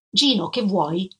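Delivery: dry, unwelcoming question; curt, dry. A narrator's reading. Woman opening her door to her former fiancé, curt and dry. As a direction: ask it coolly, with little give. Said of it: "Disse secca secca".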